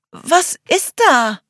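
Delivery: a scared, drawn-out demand; frightened. Acted. Charlie at the window fearing something outside, frightened cry.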